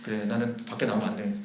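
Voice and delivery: low, subdued self-abasement; resigned. Acted. Someone called a dog pulling away from everyone, resigned. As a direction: give up quietly and withdraw, pitch dropped. Imagined withdrawing, self-abasing reply.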